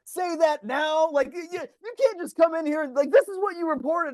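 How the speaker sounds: high, heated shouting at a teammate; furious protest over the score; angry yelling. Retold or imitated. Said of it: "People start screaming at each other".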